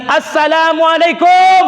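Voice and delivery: loud, slow salam called out; man calling salam to the Prophet; greeting call. Retold or imitated.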